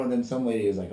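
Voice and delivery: usual speaking voice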